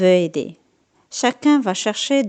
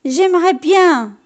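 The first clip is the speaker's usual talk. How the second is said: lamenting; 7 semitones higher, 6 dB louder than usual. A narrator's reading. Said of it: "se lamente Patapon".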